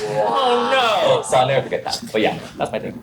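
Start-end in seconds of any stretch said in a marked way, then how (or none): 0.1-1.2 cutesy fake oh no. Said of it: "cutesy femme".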